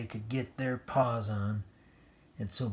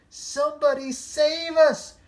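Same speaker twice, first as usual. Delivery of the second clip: very high, loud shriek; shrieking for help; 9 dB louder than usual. A narrator's reading. Dogs shrieking at the monster, very high and loud. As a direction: shriek for rescue. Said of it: "shrieked the dogs".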